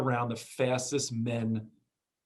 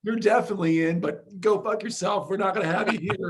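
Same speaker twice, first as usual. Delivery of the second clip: higher, punchy joke rejection, opening loud; mock blunt rejection; 6 semitones higher, 4 dB louder than usual. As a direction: play a gleeful brush-off for laughs. Host jokingly voices the rejection.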